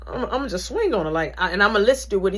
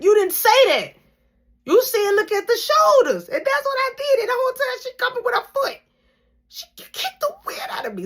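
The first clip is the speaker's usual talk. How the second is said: crying complaint; 6 dB louder than usual. Retold or imitated. Tearful complaint to instructor.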